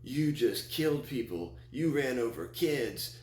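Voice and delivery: stretched retold accusation; crowd flipping out. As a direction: imitate horrified onlookers, drawn out and high. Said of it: "Just flipping out".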